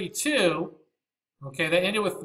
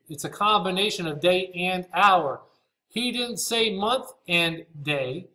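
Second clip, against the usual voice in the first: normal talking voice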